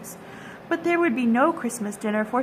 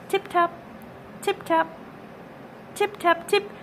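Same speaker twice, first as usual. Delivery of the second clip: tapping noises; 7 semitones higher than usual. A narrator's reading. Mice tapping sounds in story.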